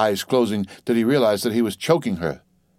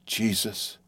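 hushed, much softer exclamation; horrified whisper; 8 dB quieter than usual. A narrator's reading. Husband reeling from the truth, whispering. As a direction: whisper it in stunned horror. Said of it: "staring about him and whispering".

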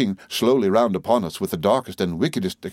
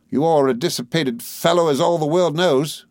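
higher voice, straining to smile; forced smile; 8 semitones higher than usual. A narrator's reading. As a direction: insult him through an effortful smile. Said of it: "with an effort at a smile".

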